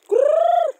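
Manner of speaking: long high loud "Kur" to call the chickens. Real, not acted. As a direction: sing out "Kur" far across the yard. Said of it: "saya cakap kur". (panggilan ayam "Kur" yang panjang, tinggi dan keras)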